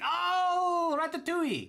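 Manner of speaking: 1 waiter's sudden recognition. Retold or imitated. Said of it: "And he's like".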